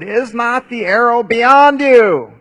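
loud, slow call across the field. Retold or imitated. Jonathan calling out to the lad in the field, loud and drawn out. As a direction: call long and high to the boy.

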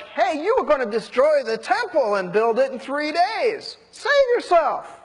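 high-pitched mocking heckle; jeering. Crowd daring Jesus to come down from the cross, jeering. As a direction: taunt him like a heckler in a crowd. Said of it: "jeering at him and mocking him".

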